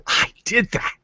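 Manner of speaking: a high, breathy I did that; whispered triumph. Retold or imitated. Student seeing their own success, awed whisper. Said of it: "they see themselves and say".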